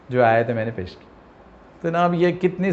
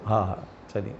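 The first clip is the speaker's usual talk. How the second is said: reluctant murmur; 6 semitones lower, 10 dB quieter than usual. Retold or imitated. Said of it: "افسوس کا اظہار کیا".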